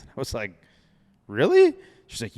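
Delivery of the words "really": sharp high disbelieving really; genuinely shocked, voice jumps up; loud startled question; surprised. Retold or imitated. Surprised by her wild idea.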